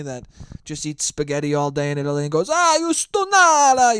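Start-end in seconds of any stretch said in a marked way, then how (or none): 2.5-4.0 high, loud Italian family yell; cartoon Italian shouting; mock scream. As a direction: holler it long and high, hammed-up Italian. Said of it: "just screams at each other".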